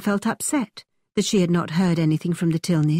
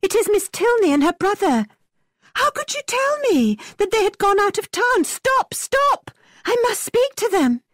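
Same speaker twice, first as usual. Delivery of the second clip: much higher, distressed and insistent; urgent pleading; 14 semitones higher than usual. A narrator's reading. Catherine begging Thorpe to stop the carriage, much higher. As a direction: beg him frantically to stop.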